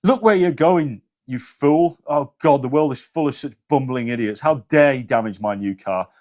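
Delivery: haughty, indignant rant; arrogant scolding. Acted. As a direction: look down your nose at the fool who hit your car.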